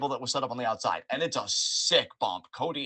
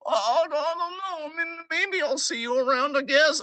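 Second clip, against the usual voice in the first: very high, fast whimpering voice; whiny mock Dom; 13 semitones higher than usual. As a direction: play Dom as a clueless, whining kid.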